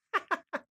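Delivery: very high, short fake laugh; put-on laugh. Acting out the film's badly timed laugh, put-on high laugh. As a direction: burst out in a silly high-pitched laugh. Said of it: "Mark turns around and he goes".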